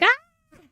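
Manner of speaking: short very high squeal; mock squeal. Reacting to calcified muscle and bone spurs, mock squeal. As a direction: let out a sudden high yelp. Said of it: "that's just like".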